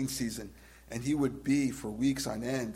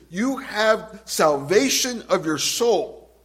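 loud, rising preacher's build; full-force preaching; 3 semitones higher, 7 dB louder than usual. Acted. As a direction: drive it home to the congregation.

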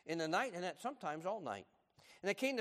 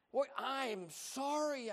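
slow, sheepish apology; 11 semitones higher, much slower than usual. Retold or imitated. Grandson apologizing to his grandmother, slow and sheepish. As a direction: drag out a guilty sorry. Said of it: "I said".

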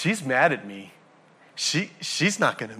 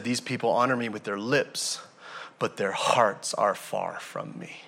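quiet scripture; 5 semitones lower, 6 dB quieter than usual. Acted. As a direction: let the verse sink in quietly. Same verse, now soft and low.

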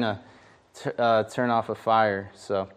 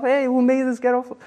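high, breezy reenactment of the blurt; careless blurt; 10 semitones higher than usual. Shown as a demonstration. Preacher replaying his careless flan remark at the party, high and breezy. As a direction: toss it off like you're just being yourself. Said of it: "I just said it like, you know, I'm just being myself".

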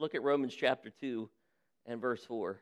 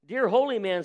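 sobbing plea; 5 semitones higher, 5 dB louder than usual. Acted. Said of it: "sobbed the farmer in despair".

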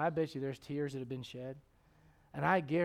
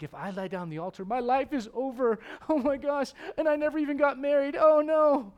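rising higher and louder into panic; mock panic; 10 semitones higher, 9 dB louder than usual. Young person fearing the altar ends life, building mock panic. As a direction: spiral into a hammed-up freakout.

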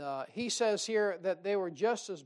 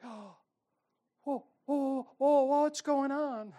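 high, loud hammed-up panic; mock alarm; 7 semitones higher, 6 dB louder, slower than usual. Preacher acting his panic when trouble comes, high and loud. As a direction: flail like trouble just hit. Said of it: "I'm like".

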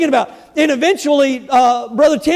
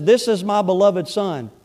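voice of God; 7 semitones lower, 5 dB quieter than usual. Retold or imitated. God speaks from heaven.

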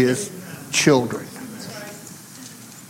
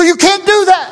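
alarmed Brother Jim; 14 semitones higher than usual. Retold or imitated. Brother Jim asks the preacher, high-pitched and alarmed.

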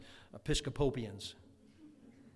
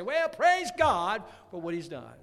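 exuberant praise; 3 semitones higher, 8 dB louder than usual. Shown as a demonstration. Models saying praise out loud.

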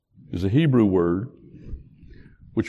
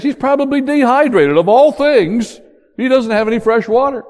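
much higher, louder, pressing the point; rising intensity; 11 semitones higher, 6 dB louder than usual. Acted. Preacher stressing Jonah's exhaustion to the congregation, higher and louder.

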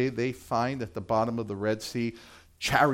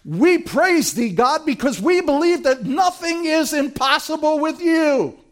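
idealised pious praise; 12 semitones higher, 5 dB louder than usual. Pretend. Israelites as they should have answered, sweetly devout. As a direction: pray like the perfect believer. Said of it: "Is that what they do?".

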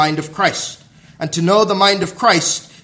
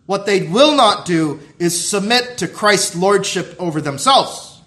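raised, driving sermon delivery; forceful preaching; 3 semitones higher, 3 dB louder than usual. Acted. Preacher warning of hypocrites who will not submit, forceful. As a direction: build the charge, punching key words.

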